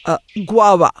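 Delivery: usual speaking voice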